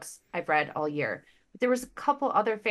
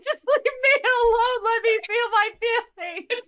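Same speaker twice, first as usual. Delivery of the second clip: far higher, louder tearful wail, slowing down; hammed-up sobbing plea to be left alone; mock crying plea; 7 dB louder than usual. Wife crying, telling husband to leave her alone, mock sobbing. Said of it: "I'm like".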